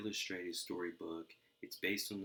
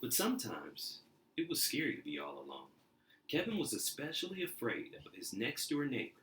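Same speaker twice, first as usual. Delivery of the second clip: lively storybook reading; animated read-aloud for kids; story narration; 4 dB louder than usual. A narrator's reading. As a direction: read it like a bedtime picture book. Reading picture book aloud.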